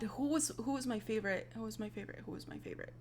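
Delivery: very soft, fading to a whisper; muttering to oneself. Real, not acted. Trying to recall a favorite, muttering to self. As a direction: trail away while racking your memory. Searching memory, talking to self.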